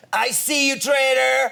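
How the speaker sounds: high, loud shout from a balcony; hollering stranger. Retold or imitated. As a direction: imitate a heckler calling out an accusation. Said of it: "this woman on the balcony went".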